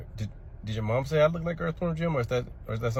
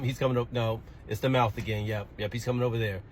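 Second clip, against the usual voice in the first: ordinary talk, nothing marked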